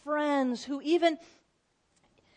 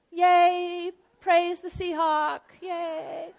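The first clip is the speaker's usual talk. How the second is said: half-hearted cheer; 4 semitones higher, much slower than usual. Retold or imitated. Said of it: "You don't want to just be like".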